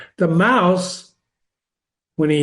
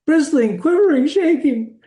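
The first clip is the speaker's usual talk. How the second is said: very high, squeaky trembling demonstration; quivering; 10 semitones higher than usual. Shown as a demonstration. Teacher showing how the mouse bristles, high quivering voice. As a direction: pitch it way up as if shaking all over.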